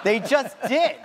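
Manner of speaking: ordinary delivery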